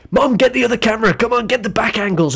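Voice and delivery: high, quick holler; mock excited calling. Friend calls Mom to film the choking, mock urgent call. Voices mate calling for camera.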